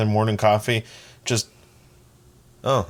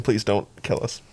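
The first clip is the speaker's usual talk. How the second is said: normal talking voice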